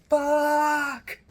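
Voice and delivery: high, very loud shouted fuck; screamed curse. Retold or imitated. Student venting on game day, screaming a curse. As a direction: hurl the word out in frustration. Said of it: "And I screamed four times".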